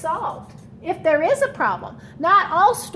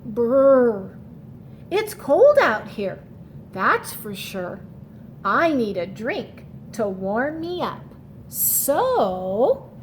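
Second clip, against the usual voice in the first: shivering snowman; slower than usual. Acted. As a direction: voice a freezing snowman for the kids. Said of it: "Sneezy the Snowman shivered".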